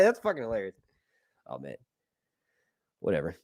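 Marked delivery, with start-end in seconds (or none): none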